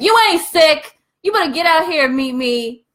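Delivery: high, loud, demanding challenge; barging in and calling her out; mock confrontation. Calling a woman out of the house, loud and confrontational.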